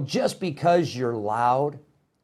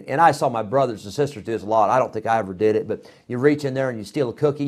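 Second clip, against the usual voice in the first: ordinary talk, nothing marked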